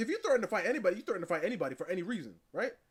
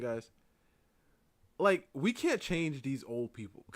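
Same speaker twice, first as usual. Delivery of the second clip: ordinary delivery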